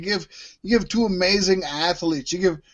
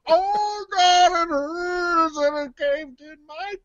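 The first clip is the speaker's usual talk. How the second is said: high wailing henchman, fading away; hammed-up agony, trailing off weakly; Henchman twenty-one; 8 semitones higher, slower than usual. Henchman after the elbow drop, in mock pain. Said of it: "I'd take twenty-one".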